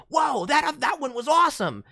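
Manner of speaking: loud, high impressed whoa; excited listener. Retold or imitated. A listener raves about the demo clip, excited shout. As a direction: gush loudly over the demo. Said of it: "somebody listens and is like".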